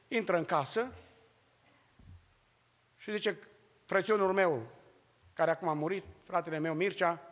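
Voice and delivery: ordinary talk, nothing marked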